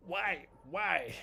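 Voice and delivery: high, pained exasperated why; exasperated cry. Real, not acted. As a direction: cry out at the market. Trade stopped out, exasperated.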